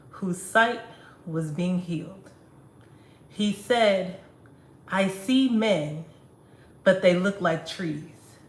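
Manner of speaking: spoken-word poem in measured phrases with pauses; poem recitation. Acted. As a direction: recite deliberately, leaning into key words. Performs own poem aloud.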